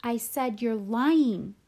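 loud, drawn-out insistence; slow, forceful angry shout; shouted accusation. A narrator's reading. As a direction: raise your voice and stretch the key word. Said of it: "I shouted".

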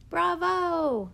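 high, loud, drawn-out bravo; yelled cheer. A narrator's reading. Dad cheering the actors from the audience, yelling. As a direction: call it out over an applauding audience. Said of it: "I heard Dad yelling through his hands".

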